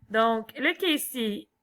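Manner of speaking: normal talking voice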